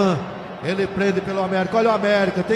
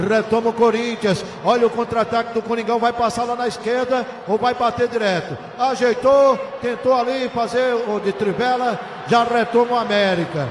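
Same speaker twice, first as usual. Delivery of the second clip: excited; 3 semitones higher, 5 dB louder than usual. Real, not acted. Counterattack called excitedly.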